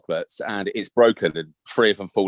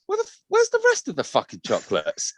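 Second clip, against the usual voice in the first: exasperated mum. Retold or imitated. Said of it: "And I was like".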